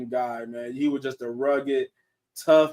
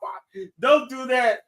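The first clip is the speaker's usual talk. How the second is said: mock shocked protest; 8 semitones higher than usual. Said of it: "I'm like".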